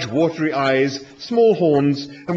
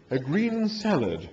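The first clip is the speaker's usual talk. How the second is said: "A green salad" repeated slow and softer, sniffy; disapproving; 6 semitones higher, 3 dB quieter, slower than usual. A narrator's reading. The animal echoing Arthur's order, disapproving. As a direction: echo "A green salad" as if it's beneath him. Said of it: "rolling its eyes disapprovingly".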